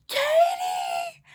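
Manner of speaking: very high, drawn-out mock wail; crying mom shout. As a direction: shriek the name like a tearful mother. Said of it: "And she's crying".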